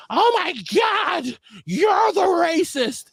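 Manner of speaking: high, slow, shouting outrage. Retold or imitated. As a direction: squeal it in mock horror.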